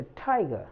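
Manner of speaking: टाइगर begun with ठ, a puffed t; aspirated t. Shown as a demonstration. Teacher demonstrating British and American t in tiger, aspirated. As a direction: puff air on the t of टाइगर. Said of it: "ट को British और American accent में ठ कहा जाता है".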